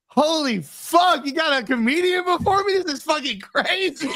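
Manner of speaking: high-pitched, drawn-out mock amazement; astonished coworker. Friend retelling a coworker's reaction to the guest, astonished. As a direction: imitate him blown away, stretching the opening words.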